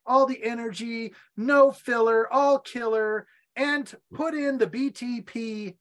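upbeat, punchy sponsor read, no filler all killer. Acted. Host reading a sponsor spot to listeners, upbeat ad read. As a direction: sell the drink to listeners.

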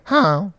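high honk, held back in volume; distant goose honk. Retold or imitated. Goose swimming in from afar, distant honk. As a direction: honk as if from across the water. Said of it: "from the distance".

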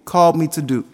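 emphatic pulpit delivery; preaching at full force. Acted. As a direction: preach it with conviction, punching key words.

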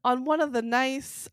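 ordinary talk, nothing marked

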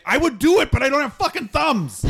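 very high, loud character voice; high-pitched shouted excuse; exasperated character outburst. Acted. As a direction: yell it high in frustration. Character shouts back in frustration.

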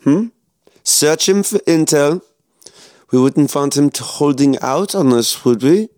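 a cold villain toying with his prisoner; menacing German officer. Acted. SS officer taunts captured soldier.

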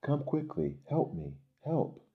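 high-pitched cry for help; storybook distress call; urgent plea. A narrator's reading. Acted boy calling for help.

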